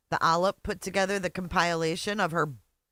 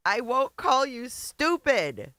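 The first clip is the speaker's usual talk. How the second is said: high, slow, sing-song mockery of her words; mocking echo; 6 semitones higher, slower than usual. Streamer mimicking the host's promise, slow sarcastic sing-song. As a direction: parrot her promise back with a sneer.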